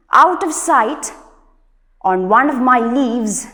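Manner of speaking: loud, measured classroom poem reading; poem recitation. Acted. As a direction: recite it line by line with firm projection. Reads the poem aloud.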